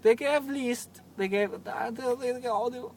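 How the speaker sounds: high mock foreign accent drifting from German; bad German accent. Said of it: "that's Borat. I'm sorry, that's bad".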